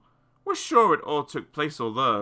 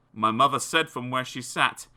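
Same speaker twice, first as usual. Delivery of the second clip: ordinary delivery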